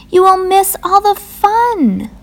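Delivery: very high, pleading Frog; crying out. A narrator's reading. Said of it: "cried Frog".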